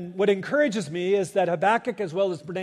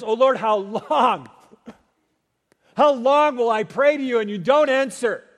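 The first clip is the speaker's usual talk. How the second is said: prophet's lament; 4 semitones higher, 6 dB louder than usual. Acted. Preacher voicing Habakkuk's lament to the congregation, loud pleading cry. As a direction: plead it like a prophet crying out to God.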